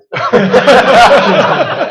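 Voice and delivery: loud high burst of laughter; big laugh. Real, not acted. Telling the panel the punchline, a loud laugh. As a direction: cracks up at the punchline.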